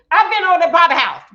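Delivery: curt retort. Acted. Acted rude husband's reply.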